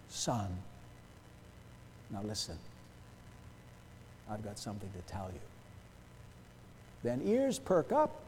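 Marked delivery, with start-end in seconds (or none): 0.1-5.4 low, soft fatherly voice, with pauses; father to son. Acted. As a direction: draw the son in quietly before important news. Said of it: "when a father says to his son".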